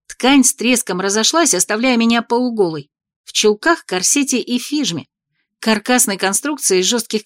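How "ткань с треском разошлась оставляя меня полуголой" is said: plain reading voice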